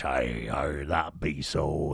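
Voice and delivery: deep, slow growling stage pirate; pirate voice from the old films; old-school pirate voice. Retold or imitated. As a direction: rumble it like an old film pirate. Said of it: "I got to do the, the pirate".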